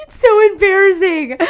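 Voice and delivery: high, loud teary laugh on embarrassing; half sobbing, half laughing it out; real tearful laughter; laughing through tears. Real, not acted. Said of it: "Dude, I was crying".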